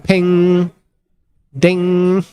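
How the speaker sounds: high, held ping like a bell; imitated ping. Shown as a demonstration. Commentator sounding the cube's arrival, high ringing ping.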